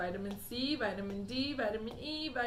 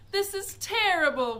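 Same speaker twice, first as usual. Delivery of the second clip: high, loud despairing wail; 9 semitones higher, 9 dB louder than usual. A narrator's reading. Mother wailing over her shrunken son. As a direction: wail in dismay. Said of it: "wailed Missus T V".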